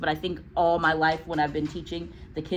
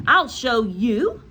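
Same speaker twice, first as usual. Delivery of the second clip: high, slow and louder eager offer; piping up; 6 semitones higher, 8 dB louder, slower than usual. Acted. Nigel bravely offers to help Miss Honey, piping and louder. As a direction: pipe up bravely and slowly. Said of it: "piped up the brave Nigel".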